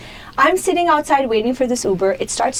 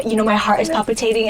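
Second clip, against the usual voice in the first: ordinary talk, nothing marked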